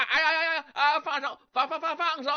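hammed-up cry to let go; crying out in pain. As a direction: howl and plead to be let go. Hand crushed, begging release.

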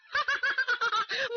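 high, cold cackle; evil laugh. A narrator's reading. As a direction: gloat with a cruel laugh. Villainess laughs.

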